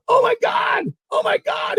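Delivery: very high mock yell; awestruck imitation. Partner sees the scooped-up fish, ecstatic. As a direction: imitate an angler losing it with excitement.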